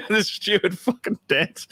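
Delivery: hammed-up annoyed read; mock exasperated line. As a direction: grumble it like a fed-up character. Mock reading a script line.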